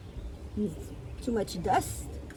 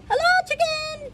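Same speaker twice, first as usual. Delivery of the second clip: very high squeaky duck greeting; duck character; 7 dB louder than usual. A narrator's reading. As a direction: call out in a tiny, high-pitched duck voice. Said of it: "said Duck".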